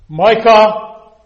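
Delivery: sudden loud cry of the name; calling out. Acted. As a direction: call the name out loud, as if summoning him.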